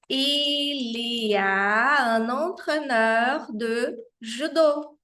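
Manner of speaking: slow, carefully articulated French; slow model reading. Shown as a demonstration. Teacher reading model sentence to students, slow. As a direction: read it out slowly for dictation. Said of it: "model sentence read slowly".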